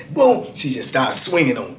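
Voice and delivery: ordinary talk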